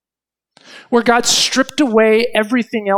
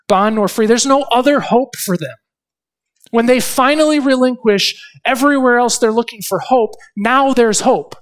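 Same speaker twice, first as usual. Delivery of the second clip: rising, louder sermon climax; preaching at full force; 5 semitones higher, 5 dB louder than usual. Acted. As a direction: drive home that only God remains. Preaching builds to hope.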